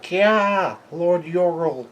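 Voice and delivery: high-pitched imitated battle cry; mock yell. Giants yelling for Lord Yorl, high mock yell. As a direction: call it out high, without real force. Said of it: "other giants are yelling out".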